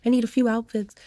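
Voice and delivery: mock-frantic high voice; retold exasperation. Acting out her packing frustration.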